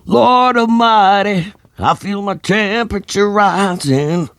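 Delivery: very high, slow, [name]-style wail; screamed [name] impression. A narrator's reading. Drunk Ed belting to the crowd, high [name] wail.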